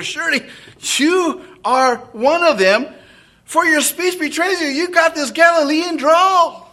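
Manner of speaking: put-on nail him for his accent; accusing bystander. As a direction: bystanders catching Peter by his speech. Bystanders expose Peter.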